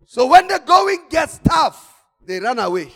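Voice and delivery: preaching at full force. Acted. Preacher raising voice.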